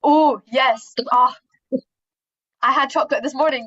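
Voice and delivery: ordinary talk, nothing marked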